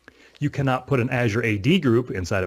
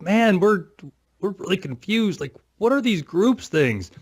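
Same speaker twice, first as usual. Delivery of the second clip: lightly hammed-up baffled voice, pitched higher; a higher, puzzled whine asking what groups are; mock confusion; 8 semitones higher, 3 dB louder, slower than usual. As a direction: play the lost, confused techie. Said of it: "we'd be like".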